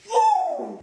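high, loud cheer; excited whoop. Acted.

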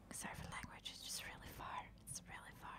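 hushed, voiceless whisper. Real, not acted. Player apologizes for swearing, the place is really far, whispering. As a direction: drop right down to a whisper. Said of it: "I don't know why I'm whispering".

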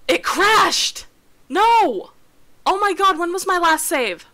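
high, loud alarm at the crash; panicked outburst. Real, not acted. Streamer panicking to chat as the game crashes, loud and high. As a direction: yelp it out as the game dies.

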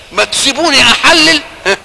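loud, rising indignant challenge in God's voice; indignant rebuke. Retold or imitated. Preacher voicing God rebuking those who forbid, loud and indignant.

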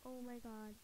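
ordinary talk

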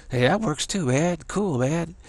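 easygoing and pleased; laid-back kid. Retold or imitated.

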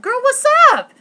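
very high, loud hammed-up sassy call; sassy greeting. Walking off stage to greet Shauna, hammed-up sassy call. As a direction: belt it like a mock diva. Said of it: "and I was like".

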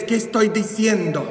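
ordinary talk, nothing marked

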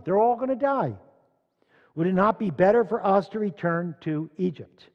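loud pulpit reading with dramatic protest; impassioned scripture reading. Acted. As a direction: preach out the people's grievance. Acts out the grumbling congregation.

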